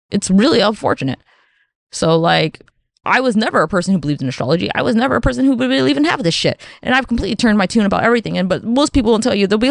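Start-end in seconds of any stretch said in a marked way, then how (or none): none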